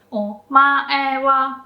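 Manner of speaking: Omae wa said slowly, only o low; slow pitch demo. Shown as a demonstration. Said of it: "only o is low".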